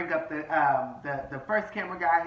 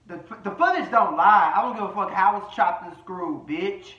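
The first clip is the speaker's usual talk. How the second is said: loud, raised-pitch outburst; heated insistence; 4 semitones higher, 9 dB louder than usual. Real, not acted. Host insisting the footage don't lie, loud and heated. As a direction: burst out louder and higher, brooking no argument.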